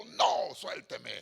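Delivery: loud retold "no", firm; angry refusal. Preacher recounting his refusal to a woman who grabbed his hand, angry. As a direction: re-enact an angry, forceful refusal. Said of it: "me le enojé a esa mujer".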